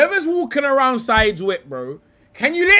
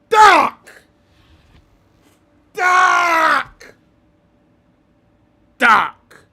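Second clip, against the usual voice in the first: mock exclamation; 4 semitones higher, 5 dB louder, much slower than usual. Real, not acted. Exclaiming at pundit's predicament.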